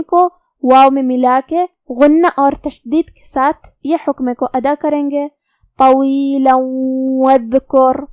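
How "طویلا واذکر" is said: very slow chanted Quran recitation; tajweed recitation. Shown as a demonstration. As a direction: chant طویلا واذکر slowly with the long alif drawn out. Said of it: "ہم کلمت طویلہ پہ رکیں گے تو اس پہ مد الف". (آہستہ تجوید والی تلاوت)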